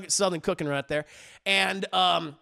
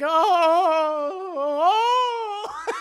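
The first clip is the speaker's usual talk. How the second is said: high, wobbly imitated wail; mock wailing; 9 semitones higher, 3 dB louder, much slower than usual. Fallen mother wailing on the floor, mock cries. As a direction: wail like a hurt old lady, hammed-up. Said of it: "She's like".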